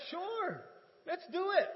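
thrilled acceptance; eager yes. Retold or imitated. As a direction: grab the offer gladly. Eagerly accepting golf invite.

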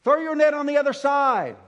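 high, loud call. Acted. Jesus calling to the boat from shore, loud and high. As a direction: shout it across the lake.

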